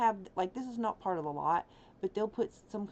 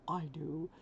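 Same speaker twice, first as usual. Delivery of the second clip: whispering; 3 semitones lower than usual. Real, not acted. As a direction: whisper it to yourself. Whispered aside after laugh.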